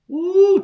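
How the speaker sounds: high loud joyful woo; preacher whooping in praise. Real, not acted. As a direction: let out a happy shout of praise. Sermon-like joy exclamation.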